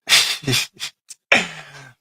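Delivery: loud high cackle; high laugh. Real, not acted. Streamer laughing about the men in Houston.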